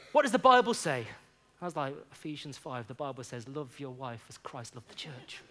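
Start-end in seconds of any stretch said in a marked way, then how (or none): 0.1-1.1 loud, pointed question, like a mentor scolding; mock stern challenge. Mentor confronting young [name], demanding scripture, loudly. 2.1-5.4 sheepish mumble. Retold or imitated. [name] answering his mentor, ashamed, softly.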